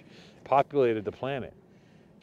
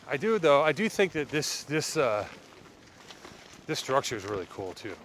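normal talking voice

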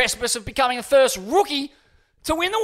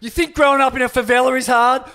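usual speaking voice

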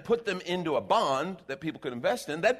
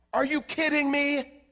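high, slow, drawn-out disbelief; incredulous; 11 semitones higher, 4 dB louder, slower than usual. Acted. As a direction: stretch out the disbelief. Incredulous preacher retort.

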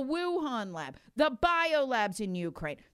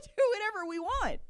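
mocking with a laugh; 8 semitones higher than usual.

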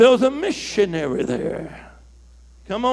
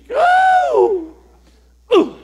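high, loud "Go"; loud high exclamation; excited shout; 5 dB louder than usual. Real, not acted. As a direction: fire it out. Preacher exclaims.

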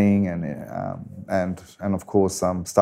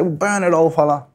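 much higher, keen imitated youngster; imitating his young eager self; eager young fella; 7 semitones higher than usual. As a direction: push the old man to burn it. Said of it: "and I'll be say".

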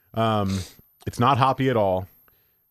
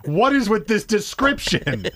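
laughing; 10 semitones higher, slower than usual. Real, not acted. Laughs at beer can text.

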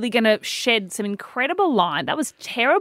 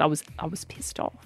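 whispered admission; 6 semitones lower, 10 dB quieter than usual. Retold or imitated. Sister admits being angry.

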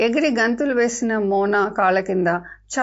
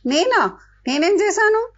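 high, astonished who, me; a girl stunned to be blamed; surprised; 10 semitones higher than usual. A narrator's reading. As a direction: caught off guard, voice jumping up. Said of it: "అంది నిధి ఆశ్చర్యంగా".

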